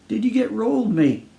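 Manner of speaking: lightly voiced Aussie stranger calling again; Australian calling again. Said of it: "A tall Australian called out again".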